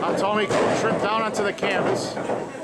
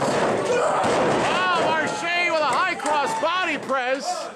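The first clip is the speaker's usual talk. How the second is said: excited; 6 semitones higher, much slower than usual. Real, not acted. Excited call of a high-flying move.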